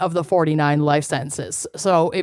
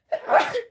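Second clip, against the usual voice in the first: sneeze. Real, not acted. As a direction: let the sneeze burst out. Sneeze bursts out.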